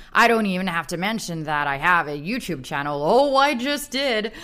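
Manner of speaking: put-on upbeat American accent, fan review read; American accent, bright and enthusiastic. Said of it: "I'm gonna do it in my American accent".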